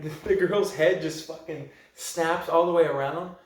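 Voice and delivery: normal talking voice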